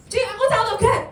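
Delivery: high, loud, excited answer; eager shout. Shown as a demonstration. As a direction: shout it out proudly like a keen kid.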